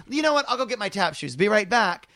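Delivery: loud, high, bubbly announcement; eager showbiz exit. Retold or imitated. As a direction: chirp it out, all eager to perform. Lisa insisting on tap shoes.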